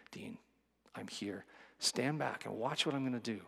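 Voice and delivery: gentle voice of God. Retold or imitated. God speaking softly to him.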